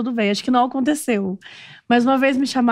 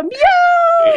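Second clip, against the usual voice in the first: excited squeal; 5 dB louder than usual. Real, not acted. Imita a cena rindo.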